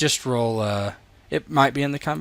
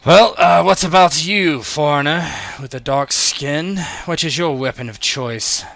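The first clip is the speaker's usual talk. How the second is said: loud, higher voice addressing a newcomer; commanding arena master; 5 semitones higher, 7 dB louder than usual. Acted. Master asks a foreigner his weapon of choice, loud and commanding. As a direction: call out the question imperiously.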